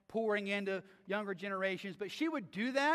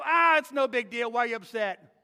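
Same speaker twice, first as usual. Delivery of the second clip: dismissive; 8 dB louder than usual. Retold or imitated. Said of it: "Don't look back at 'em and go".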